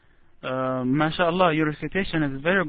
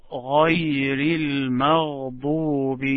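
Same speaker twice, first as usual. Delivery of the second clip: Quranic recitation. Shown as a demonstration.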